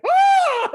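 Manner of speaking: very high, loud mock shriek; startled shriek. Stranger shrieking at the intruder, very high and loud. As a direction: shriek as if caught off guard.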